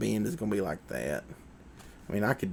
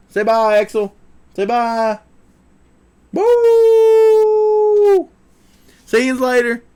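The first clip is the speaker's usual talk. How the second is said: very high, loud pet-talk goodbye; 13 semitones higher, 13 dB louder, much slower than usual. Real, not acted. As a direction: squeal goodbye on the dog's behalf. Says bye with the dog.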